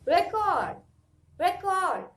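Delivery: loud "Record" with a firm REC, pitch falling at the end; stress on first syllable. Shown as a demonstration. Teacher corrects the noun for students, "Record" stressed on rec. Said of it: "It's just record".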